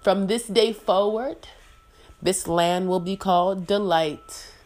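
loud, commanding queen voice; royal decree. Pretend. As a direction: decree it from the throne.

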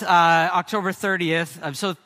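normal talking voice

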